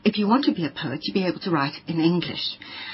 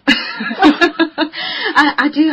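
laughing; 8 semitones higher, 7 dB louder, much slower than usual. Real, not acted. Laughs about divorce memorial.

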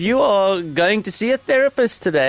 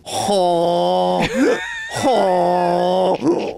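hee-haw it out like a donkey; donkey sounds. Pretend. Host braying like a donkey at his co-host. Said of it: "You don't like my donkey sounds?".

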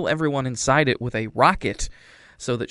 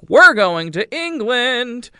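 excited announcement; 12 semitones higher, 9 dB louder, slower than usual. Retold or imitated. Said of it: "Warbucks is like".

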